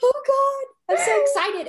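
very high, loud, gushing squeal; squealing excitement. Retold or imitated. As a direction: squeal like a thrilled fan. Retold gushing about the book.

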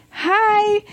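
high-pitched, stretched-out hi; mock greeting back. Daughter answering the greeting from truck, mock high hi. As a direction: echo the greeting high and sustained.

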